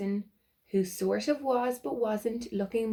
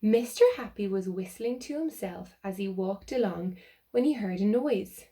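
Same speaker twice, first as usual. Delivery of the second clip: ordinary delivery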